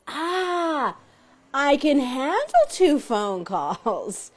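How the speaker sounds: relieved. Retold or imitated. Relief at the real number.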